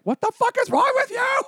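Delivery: very high, loud mock shriek; furious agent. Agents scolding client over new mohawk, furious shriek. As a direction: imitate an outraged agent screeching.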